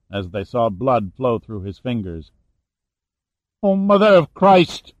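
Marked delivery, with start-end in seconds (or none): 3.6-4.9 loud, high cry of pain; agonized cry. A narrator's reading. Wounded man clutching his eyes, crying out. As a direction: cry out in agony, voice raised high. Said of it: "Julio cried".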